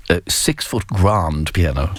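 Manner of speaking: slow, savoured echo of a posh caller; mock posh echo. Host echoing caller's piano boast in slow mock posh. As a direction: roll it out slowly like a posh caller. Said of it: "you sound a bit posh as well".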